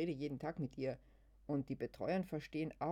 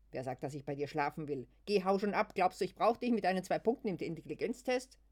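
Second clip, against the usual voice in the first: louder furious rant, rising high; angry outburst; 5 semitones higher, 8 dB louder than usual. Acted. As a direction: drive her off with rage. Wütender Ausbruch Luises. (wütend und laut hinausgeschleudert)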